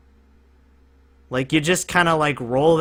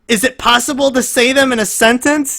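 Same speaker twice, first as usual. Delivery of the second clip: lightly voiced cartoon character, higher; SpongeBob quote; 10 semitones higher, 5 dB louder than usual. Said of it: "that SpongeBob clip where he's like".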